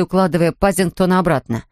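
plain reading voice

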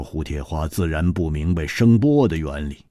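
ordinary delivery, nothing marked

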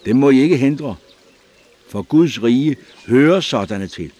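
loud and high rebuke; shouting. A narrator's reading. Prophet rebukes his disciples, loud and high. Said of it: "råbte han".

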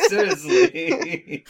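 slow word broken up by high laughter; laughing. Real, not acted. Player objecting to the break-in plan, laughing. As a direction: crack up on the word.

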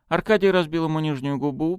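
usual speaking voice

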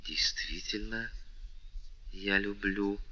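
barely audible confession; whisper. A narrator's reading. The princess confesses love to the count, whispering. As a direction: breathe it so only he hears. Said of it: "прошептала она так тихо".

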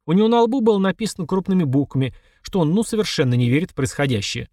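ordinary delivery

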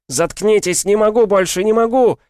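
headache scream. Acted. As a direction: break down screaming in pain. Unbearable headache, breaking.

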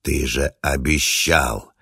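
slow, eerie otherworldly reproach; a ghost crying out betrayal; inhuman ancient voice. Acted. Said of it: "далёкий, страшный, нечеловеческий".

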